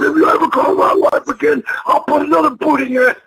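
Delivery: high-pitched mock threat, half-laughing; retold angry threat. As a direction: voice the furious friend warning him off. Brody threatens Geigel.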